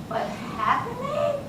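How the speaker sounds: high, soft questioning happening; incredulous. Retold or imitated. Speaker doubting the teacher's word to the audience, incredulous.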